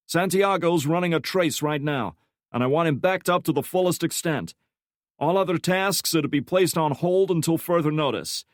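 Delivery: high, loud commanding boss voice; rally the whole team like a section chief; York commanding the room. A narrator's reading.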